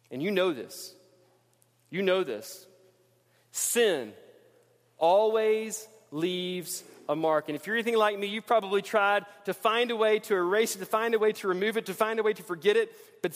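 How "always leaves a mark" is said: each word set apart, very slow; slow refrain. Acted. Preacher to congregation, very slow weighty refrain. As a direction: drag it out so it lands.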